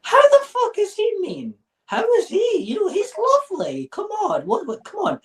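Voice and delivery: high, gushing mock disbelief; mock adoring fan. Public Scientologist defending his leader, mock gushing high voice. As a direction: squeak out a doting member's protest. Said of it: "the way that they view C O B".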